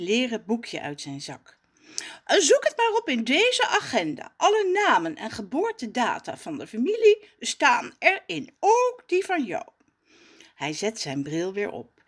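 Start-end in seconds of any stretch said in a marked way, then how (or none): 2.2-9.7 higher, louder snooty correction; posh voice. Acted. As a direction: correct the name haughtily. Jacques voice.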